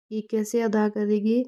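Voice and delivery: normal talking voice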